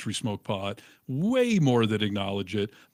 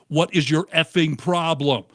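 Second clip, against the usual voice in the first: retold angry shout; 5 semitones higher, 5 dB louder than usual. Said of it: "was like".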